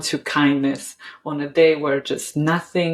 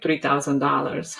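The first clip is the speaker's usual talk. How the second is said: ordinary talk, nothing marked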